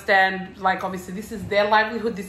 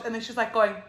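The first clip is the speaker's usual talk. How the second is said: normal talking voice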